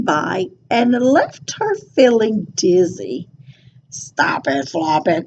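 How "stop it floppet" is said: low, soft grumbling cow; grumbled. A narrator's reading. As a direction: grumble it out lower and quieter. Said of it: "grumbled Cow".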